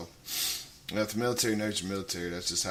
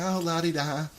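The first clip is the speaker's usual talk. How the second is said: higher-pitched la-di-da put-on voice; mock dainty voice; 5 semitones higher than usual. Mocking a speaker who gets no attention, dainty put-on voice. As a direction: make fun of a speaker nobody would listen to. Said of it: "get up there with that type of voice".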